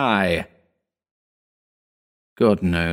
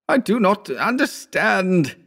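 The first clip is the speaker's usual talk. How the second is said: slow, high, quavering; barely holding together; quivering hysterical; 13 semitones higher than usual. A narrator's reading. Howard confused and shaken, slow high quavering voice. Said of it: "his voice quivered hysterically".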